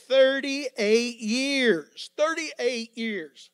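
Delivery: loud, slow thirty-eight years; emphatic repetition. Acted. Preacher hammering the years to his congregation, loud and slow. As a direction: pound each word, drawn out.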